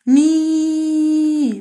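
held madd demo; long vowel. Shown as a demonstration. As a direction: stretch the ii to show the madd. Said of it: "مد بالياء".

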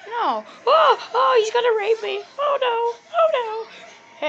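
high, loud falsetto wail; mock panic. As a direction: play a hammed-up damsel shrieking in distress. Voices the threatened wife in mock panic.

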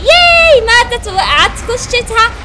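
high, loud mocking triumph; excited victorious shouting; loud gloating. Real, not acted. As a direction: gloat loudly over the boss. Mocking the defeated boss.

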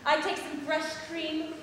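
normal talking voice

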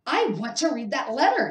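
high, loud whining demand; loudly. A narrator's reading. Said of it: "he said loudly".

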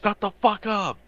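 loud, high yell; shouted retort. Pretend. Player shouting back at Blade's advice, shouted retort. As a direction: shout them down at full volume.

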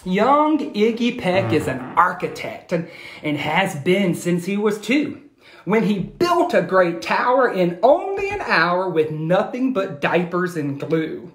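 put-on lively read-aloud to children; animated picture-book reading; storybook narration. A narrator's reading. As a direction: tell it like a bedtime story.

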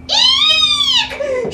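very high loud squeal of fright; squealing eek. Acted. Elephant squealing at the mouse, high terrified eek. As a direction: squeal like you saw a mouse. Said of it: "shouted the elephant".

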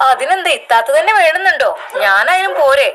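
a cheeky offer to go instead. Acted.

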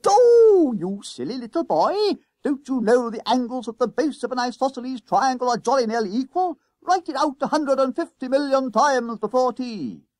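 high, hammed-up ranting maths master; schoolboy's exaggerated impersonation of an irate teacher; Wilkie. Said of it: "He goes like this. / The impersonation was interrupted".

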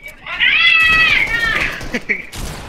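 long high-pitched laughter. Real, not acted. Laughs at teammate.

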